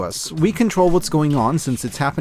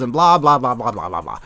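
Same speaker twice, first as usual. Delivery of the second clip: dismissive blah blah; 5 semitones lower than usual. Acted. Dismissive list trailing away.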